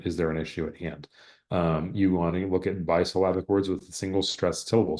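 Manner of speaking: normal talking voice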